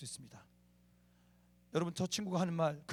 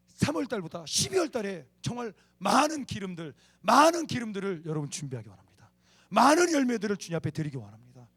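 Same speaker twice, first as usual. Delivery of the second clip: loud, with stretched emphatic words; fervent preaching; 4 semitones higher, 9 dB louder than usual. Real, not acted. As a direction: swell on each promise of abundance. Preaches at full force.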